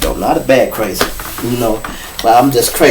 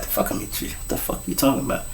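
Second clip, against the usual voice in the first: whispered; 16 dB quieter, faster than usual. Retold or imitated. Confused whispered reply.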